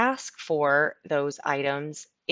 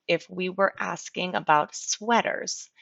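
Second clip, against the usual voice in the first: usual speaking voice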